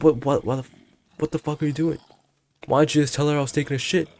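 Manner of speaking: high-pitched mock telling-off; scolding friends. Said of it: "I started scolding".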